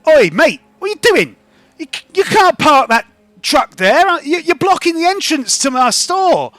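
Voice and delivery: high, loud, indignant shopkeeper; angry shopkeeper. Acted. Shopkeeper confronting a driver blocking his entrance, indignant. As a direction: tell him off loudly, pitched well up.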